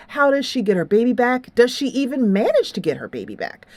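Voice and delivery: normal talking voice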